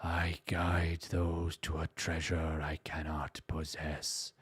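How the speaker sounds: low, soft, whispered German villain; hushed menace, pushing breath out; powerful whisper. Pretend. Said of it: "whispering with power".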